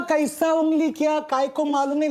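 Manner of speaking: high-pitched mock South Indian bafflement; animated comic South Indian imitation; South Indian accent. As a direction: get carried away as the South Indian eyewitness. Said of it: "South Indian man describing".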